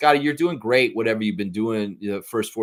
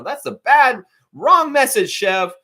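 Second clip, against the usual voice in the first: scolding; 9 semitones higher, 5 dB louder than usual. Real, not acted. Scolding Chev directly.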